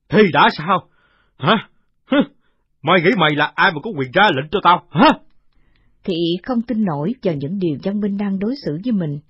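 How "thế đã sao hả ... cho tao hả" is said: sneering villain. Acted. Villain mocking.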